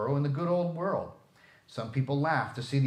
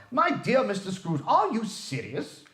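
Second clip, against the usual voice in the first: higher, stunned, slow disbelief; breathless astonishment; 8 semitones higher, 3 dB louder than usual. A narrator's reading. Gentleman stunned by Scrooge's generosity, breathless disbelief. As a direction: gasp it out as if winded. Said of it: "as if his breath were gone".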